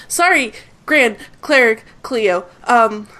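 stammer, caught off guard; flustered guard. Acted. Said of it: "salutes her".

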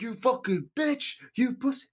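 high shrill-pitched mock yell; angry insult. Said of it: "he's like".

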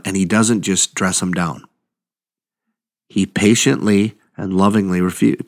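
ordinary talk, nothing marked

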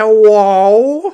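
loud, high, stretched-out put-on hello; goofy signature greeting. Shown as a demonstration. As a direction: belt it out high and drawn-out. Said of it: "better than what I do, which is".

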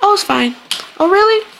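high-pitched, airy fake politeness; put-on sweet high voice; fake-nice reply. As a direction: let it float up high, falsely fine.

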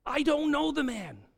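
high-pitched insistent oath; emphatic retold denial; sworn denial. A narrator's reading. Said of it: "called down curses on himself and swore".

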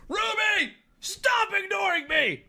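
very high mock-angry yell; all-caps shouting. Streamer reads man's all-caps text to Ruby, high mock-furious shout. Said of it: "We are in all caps now".

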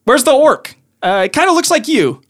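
high-pitched, chatty gnome voice; casual banter, pitched well up; gnome sorcerer. Acted. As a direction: pipe up as a little gnome sorcerer.

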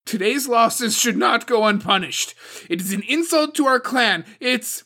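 loud, high, harsh and battering; harsh angry outburst. A narrator's reading. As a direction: hammer the council into submission. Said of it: "the harshness of her voice slamming into the others".

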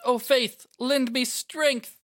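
high, drawn-out heroic invocation; fervent plea. Acted. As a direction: implore the gods for help. Yuna prays in battle, acted.

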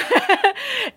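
short high giggle; a quick amused giggle. Real, not acted. Laughs after "more than a friend.

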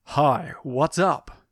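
slow, manly action-hero voice. Retold or imitated. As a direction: play the cool action hero, drawn out. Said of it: "That's me with a deeper, more manly voice".

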